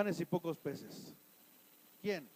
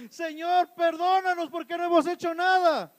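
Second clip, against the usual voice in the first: higher, louder pleading cry to the Lord; imitated tearful prayer; 8 semitones higher, 5 dB louder than usual. Pastor retelling a sister's morning prayer, as a higher, louder tearful plea. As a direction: echo a sister clamoring and weeping in prayer. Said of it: "clamando, llorando".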